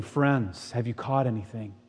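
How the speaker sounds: put-on soft, kind greeting to old friends; Jesus calling tenderly from the shore; gentle call. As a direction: welcome them back softly. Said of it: "here's Jesus on the shore saying".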